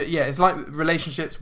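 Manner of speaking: ordinary delivery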